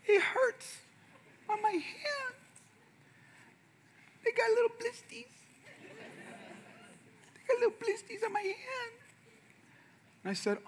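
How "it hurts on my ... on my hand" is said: put-on high, soft, whimpering little-boy voice; whiny kid. Son whining to dad about blistered hands, high whimper. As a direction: whine it like a hurt child showing his blisters.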